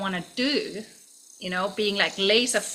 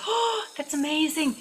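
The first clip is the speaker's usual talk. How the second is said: delighted; 8 semitones higher than usual. Retold or imitated. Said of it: "And she's like".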